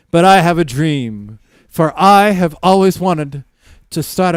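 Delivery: loud, high, grandly declaimed hard-luck plea; hammed-up street orator pitching a dream; homeless storyteller. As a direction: proclaim each phrase loudly, with pauses between. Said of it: "there's a homeless guy, you get a story".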